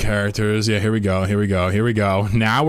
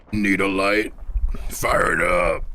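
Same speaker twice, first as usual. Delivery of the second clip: Firebat; slower than usual. Acted. Streamer imitating a game unit, put-on rough voice. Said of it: "Where's the fire bat?".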